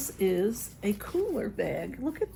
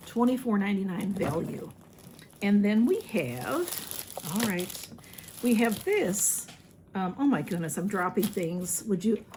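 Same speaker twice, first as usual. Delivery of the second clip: ordinary delivery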